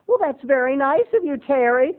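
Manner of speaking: mom. Retold or imitated. Mom thanking her son, high sweet voice. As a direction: chirp it like a pleased mother. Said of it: "mom voiced".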